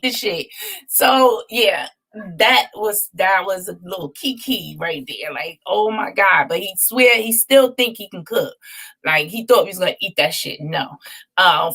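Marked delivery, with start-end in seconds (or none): none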